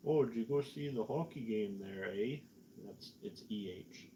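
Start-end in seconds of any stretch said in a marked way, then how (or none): 0.1-2.4 mock Canadian accent with a tagged-on "eh". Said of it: "when you hear a Canadian be like".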